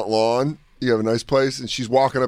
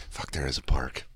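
quiet dread; 14 semitones lower, 11 dB quieter than usual. Retold or imitated. Dad's inner realization.

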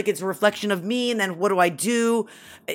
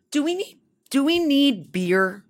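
put-on child's voice; 7 semitones higher than usual. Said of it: "This is my five and a half year old".

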